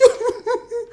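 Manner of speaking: very high mock sobbing; hammed-up sob. Josh missing Heather, mock falsetto sob. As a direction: break down in squeaky fake tears. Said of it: "Josh is crying and stuff".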